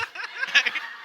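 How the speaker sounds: short high burst of laughing; real laugh bubbling up; laughter. Real, not acted. Laughing at own joke.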